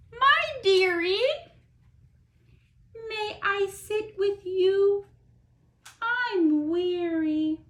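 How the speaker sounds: high, tender turtle asking to sit; turtle's voice. Acted. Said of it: "And the turtle said".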